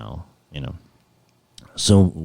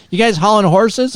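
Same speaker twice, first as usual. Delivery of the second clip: guard's question; 12 semitones higher, 3 dB louder than usual. Retold or imitated. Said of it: "She goes".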